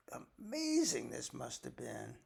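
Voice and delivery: usual speaking voice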